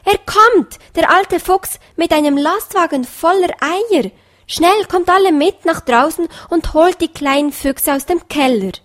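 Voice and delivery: hopping with joy as you call; bunny calling his family to the window, overjoyed; joyful shout. A narrator's reading. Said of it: "fing vor Freude an zu hüpfen und rief".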